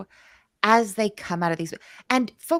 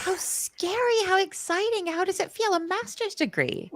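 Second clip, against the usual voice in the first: excited; 11 semitones higher than usual. Real, not acted. Host thrilled by guest's master's degree, high excited. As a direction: gasp it out, thrilled for her.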